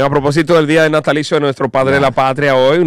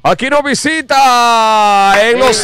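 raised, slow announcer call; radio host announcement; 7 semitones higher, slower than usual. Acted. Host presents the guest to listeners, announcer voice.

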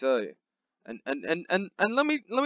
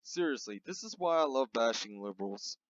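usual speaking voice

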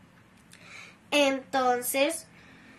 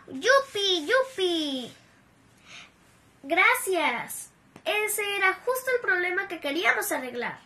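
alegría; 5 semitones higher than usual. Acted. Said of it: "saltaron de felicidad".

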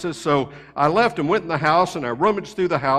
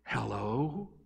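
put-on small, hushed child's hello; a timid kid picking up the phone, hushed; quiet little boy; 5 semitones lower, 12 dB quieter than usual. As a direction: answer softly like a shy little boy. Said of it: "real quiet and soft".

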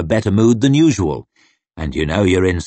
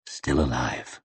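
barely voiced, very soft whisper to himself; 8 semitones lower, 10 dB quieter than usual. A narrator's reading. Logan whispers to himself on the wall, very soft whisper. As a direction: breathe it out softly, barely a sound. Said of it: "he whispered to himself".

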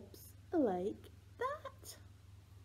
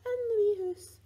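high, loud playful squeal on the rhyme's last line; excited squeal; 13 semitones higher, 12 dB louder than usual. Pretend. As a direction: burst up into a delighted squeal as the tickle lands. Rhyme climax, tickling baby.

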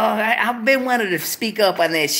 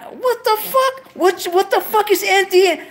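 high, louder squeal of confusion; baffled outburst; 13 semitones higher, 3 dB louder than usual. Real, not acted. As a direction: shriek at the screen in disbelief. Baffled by chat abbreviations.

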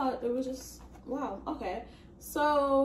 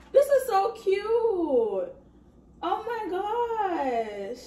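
higher, louder, drawn-out delight; delighted gushing; 8 semitones higher, 5 dB louder, much slower than usual. Real, not acted. Reader seeing childhood pictures in cousin's book, gushing. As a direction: gush over it, high and loud.

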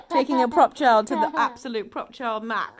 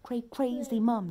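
ordinary delivery

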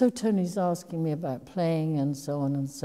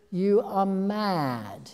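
slow, higher, louder drawn-out disbelief; imitating her ex-husband, aghast and emphatic; Tony Richardson's voice; 5 semitones higher, 6 dB louder, much slower than usual. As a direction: stretch it out as if she's lost her mind. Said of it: "in that kind of voice".

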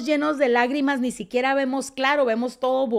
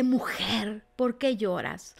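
whispered, much softer tender question; 5 dB quieter than usual. Acted. Jesus first approaches the crying Mary, whispered softly. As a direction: whisper it as if close to her.

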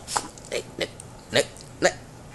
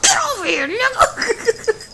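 playful shout; 14 semitones higher, slower than usual. Acted. Playful chase, calling out.

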